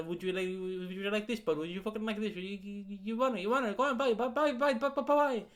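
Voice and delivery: stammering Cork pitch building to high, repeated urging; Cork seller. Retold or imitated. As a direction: pester the customer in mock Cork sing-song. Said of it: "You, you Cork people are weird".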